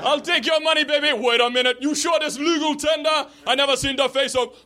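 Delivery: put-on high, yelled Jamaican accent; Jamaican cab driver. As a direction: exclaim in Jamaican-accented amazement. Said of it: "my Jamaican accent".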